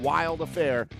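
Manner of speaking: ordinary talk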